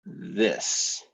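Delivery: usual speaking voice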